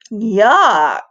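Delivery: put-on loud little girl yelp; yelping toddler. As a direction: yelp it in disgust. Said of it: "yelped Vericella".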